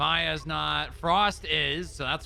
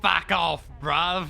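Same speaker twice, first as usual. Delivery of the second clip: hammed-up Cockney brush-off, louder; mock British accent; 4 dB louder, slower than usual. Cop teasing partner in mock British accent. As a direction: spit it out like a London lad. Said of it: "talking like I'm British".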